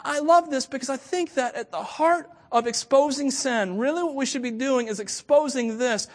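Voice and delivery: ordinary delivery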